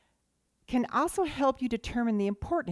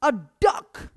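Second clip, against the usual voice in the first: a hint of a shout from the back; mock yell; 4 semitones higher, 8 dB louder than usual. King Arthur yelling from the back, mild mock yell. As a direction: call it out from the back of the crowd. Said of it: "King Arthur in the back yells out".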